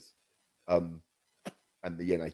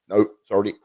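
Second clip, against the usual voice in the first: ordinary delivery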